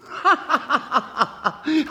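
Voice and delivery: high, loud jeering laugh; mocking laugh. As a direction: laugh out at the fool. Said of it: "laughed outright".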